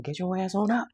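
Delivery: get-up-now tough love, pitched high; sassy tough-love order. Acted. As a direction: bark it like a sassy aunt. Quoting blunt spirit.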